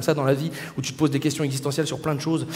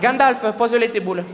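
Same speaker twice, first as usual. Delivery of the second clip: sing-song southern French calling out to friends; accent du Midi, aigu et fort; 4 semitones higher, slower than usual. Pretend. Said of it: "l'accent du Sud".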